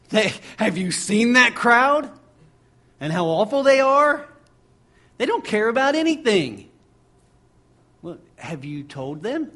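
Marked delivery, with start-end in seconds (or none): 0.1-4.3 high, loud scoffing voice of the doubters; hammed-up indignant complaint, pitched up and loud; mock disciples' complaint. 5.2-6.7 scoffing complaint. Retold or imitated. Continues the mocked complaint.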